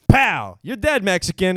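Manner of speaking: loud, high improv gunslinger; hammy cowboy shout. Pretend. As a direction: overact a bad Western line. Acting cowboy shootout in improv.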